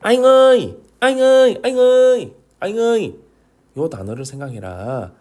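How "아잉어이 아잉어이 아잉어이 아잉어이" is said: 아잉어이 high and louder, falling at the end; stretched 아잉어이 with a final drop; word drill. Shown as a demonstration. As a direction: chant 아잉어이 as a memory word. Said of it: "어떤 단어를 생각하라?".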